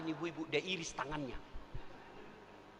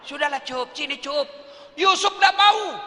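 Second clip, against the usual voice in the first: loud coaxing; 9 semitones higher, 11 dB louder than usual. Acted. Temptress coaxing then refusal.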